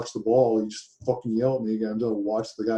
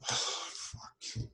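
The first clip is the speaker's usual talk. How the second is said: heavy sigh into a whispered curse; exasperated sigh; 12 dB quieter than usual. Real, not acted. Client spent after venting about the yelling, exasperated sigh. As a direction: breathe the curse out, very soft and voiceless.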